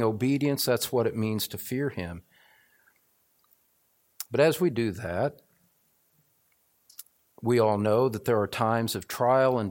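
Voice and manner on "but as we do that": ordinary talk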